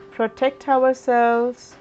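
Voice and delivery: usual speaking voice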